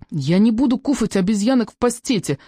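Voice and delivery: put-on high lisping little-kid voice; squeaky whining. A narrator's reading. Small boy refuses monkey pâté, squeaky and whiny. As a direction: squeak a refusal, close to whimpering. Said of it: "пропищал Жан Д и начал хныкать".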